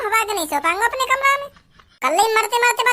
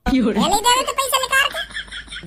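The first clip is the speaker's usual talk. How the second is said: slow, laughing mockery of the father; mocking laugh; slower than usual. Son teasing father for giving in, slow laughing mock. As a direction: drag it out, chuckling as you tease.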